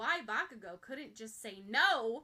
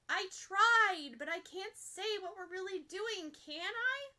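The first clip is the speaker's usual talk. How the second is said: exasperated protest; 8 semitones higher, 6 dB louder, slower than usual. Acted. Ochako protests to Bakugo.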